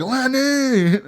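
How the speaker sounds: put-on high, drawn-out drunken call; mock scream. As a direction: imitate a drunk hollering a name. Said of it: "Fucking screaming Lenny at the bar".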